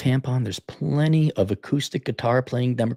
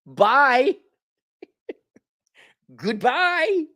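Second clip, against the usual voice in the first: mock goodbye; 11 semitones higher, 3 dB louder, much slower than usual. Mock dismissal in falsetto.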